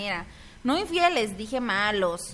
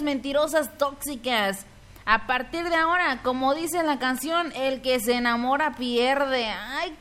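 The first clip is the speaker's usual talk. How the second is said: usual speaking voice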